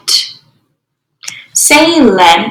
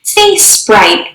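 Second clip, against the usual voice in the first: ordinary talk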